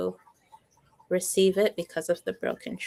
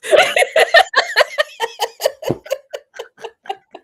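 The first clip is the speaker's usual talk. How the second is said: loud high laughter; 8 dB louder than usual. Real, not acted. Long laugh after joke.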